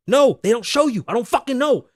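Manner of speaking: normal talking voice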